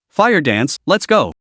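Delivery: ordinary delivery, nothing marked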